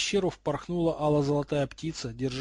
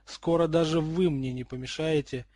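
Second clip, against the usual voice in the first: ordinary delivery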